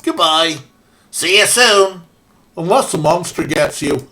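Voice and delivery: put-on loud, chirpy Midwest accent; hammed-up Fargo-style Midwest goodbye. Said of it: "my best approximation of some sort of Midwest accent".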